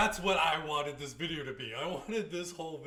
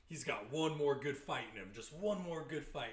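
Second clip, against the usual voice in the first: normal talking voice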